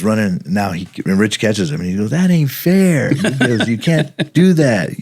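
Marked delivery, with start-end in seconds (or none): 2.1-4.9 put-on high, slow whining kid voice; mock outrage of a kid caught by two cops; whiny protest. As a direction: complain like a caught kid who thinks it's unfair. Caught suspect complaining.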